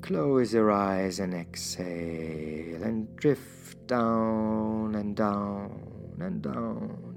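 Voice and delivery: ordinary talk, nothing marked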